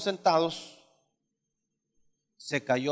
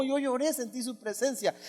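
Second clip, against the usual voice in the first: ordinary talk, nothing marked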